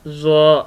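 ज़्वा thick and heavy, tongue tip on the upper gum; heavy letter demo. Shown as a demonstration. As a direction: repeat ज़्वा heavily for the class. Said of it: "लफ्ज़ ज़्वा अदा होगा".

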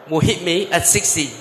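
ordinary talk, nothing marked

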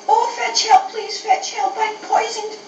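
very high, panicky yelping plea; yelping in pain. Acted. Poisoned little dog begs for help, acted yelping. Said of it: "He had a dreadful yelp".

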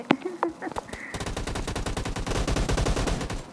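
long airy laugh at her own joke; breathy laugh. Real, not acted. Streamer laughing at her own chat quote, soft airy laugh. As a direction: laugh it off softly.

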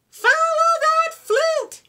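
high yelling chase call; yelled. A narrator's reading. Fifi and Frog chasing the flute, high yell. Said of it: "yelled Fifi and Frog".

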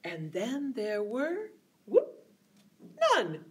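ordinary delivery

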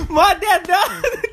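high breaking laughter; laughing. Real, not acted. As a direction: laugh through the words. Laughing through the sentence.

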